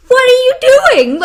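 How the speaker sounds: mock outraged yell, high and loud; retold angry yell. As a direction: yell it in high-pitched exasperation. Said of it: "she yells at him".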